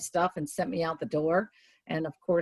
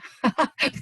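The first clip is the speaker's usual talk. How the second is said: brief, high, loud laugh; short laugh; 8 semitones higher, 6 dB louder than usual. Real, not acted. Speaker admitting someone is very demanding this week, short laugh. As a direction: let out a quick high chuckle.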